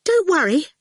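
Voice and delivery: a scared squeak trying to comfort; tiny squeak of false courage; small squeaky voice. Acted. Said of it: "in a small, squeaky voice".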